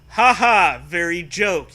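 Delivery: deadpan fake amusement; sarcastic reply. The kid answers Zoe's joke with sarcasm. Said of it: "you reply".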